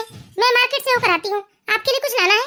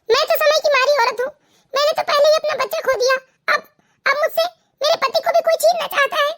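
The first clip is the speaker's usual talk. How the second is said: weeping, pitched-up plea; crying; 3 semitones higher than usual. Acted. Wife begging mother-in-law to save her marriage, crying. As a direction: beg for help through sobs. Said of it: "रोने धोने की जरूरत नहीं है".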